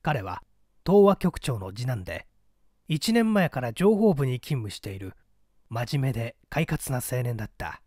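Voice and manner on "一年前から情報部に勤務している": plain reading voice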